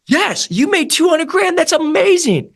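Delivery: high-pitched, drawn-out cheer; thrilled congratulation. Acted. Coach cheering on a rep's big earnings, thrilled congratulation. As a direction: celebrate their win with a big, high-pitched whoop of praise.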